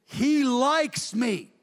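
high, drawn-out proclamation; jubilant declaration. Acted. David explains why God did this, jubilant and emphatic. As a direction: stretch each word out in triumph.

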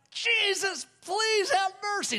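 very high, wailing plea; imitated desperate plea. Preacher imitates an unbeliever falling down, high pleading wail. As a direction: beg for mercy in a high mock wail. Imitates man begging as he falls.

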